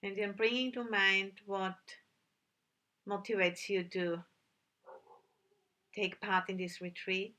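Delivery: calm meditation guide with long, unhurried pauses; measured, settled voice, leaving silence between instructions; guided meditation. Real, not acted. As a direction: gently lead sitters inward, phrase by phrase.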